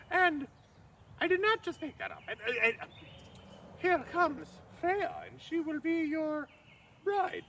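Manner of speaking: high simpering maid's voice; falsetto maid. Acted. Loki in disguise presents the bride to Thrym in falsetto. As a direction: keep the high fawning disguise voice.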